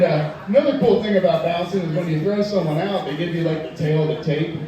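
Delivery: ordinary delivery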